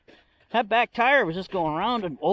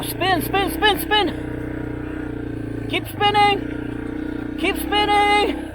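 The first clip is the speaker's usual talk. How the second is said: high, slow strained chant; high-pitched urging; 12 semitones higher, 3 dB louder, much slower than usual. Real, not acted. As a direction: drag it out high and tense. Urging tires to spin.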